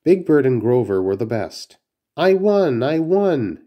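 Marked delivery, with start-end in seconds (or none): 2.2-3.6 high, gleeful cry of victory; excited shout. Acted. Big Bird at the party game, shouting that he won. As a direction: crow about winning the game. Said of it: "shouted Big Bird".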